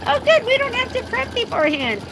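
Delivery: high-pitched retold relief and excitement; mildly giddy, pitched high; a little excited. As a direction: act out happy relief, pitched well up. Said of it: "I was a little excited".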